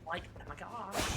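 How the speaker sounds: put-on high Valley Girl voice; airy teen Valley Girl; Valley Girl impression. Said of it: "bitchy Valley Girl impression".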